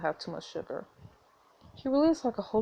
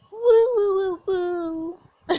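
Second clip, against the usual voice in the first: high wailing robot coos; high-pitched cute bot calls; cute robot noises; 10 semitones higher, 6 dB louder, much slower than usual. Acted. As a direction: coo high, fading off like a little bot. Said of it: "the sounds he always makes".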